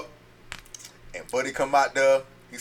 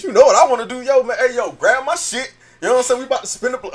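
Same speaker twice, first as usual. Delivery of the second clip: high and loud, fired up; retold hyped answer; 10 semitones higher, 5 dB louder, faster than usual. Answering his partner on the phone, pitched-up and hyped. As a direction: hype it, ready to ride. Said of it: "I said".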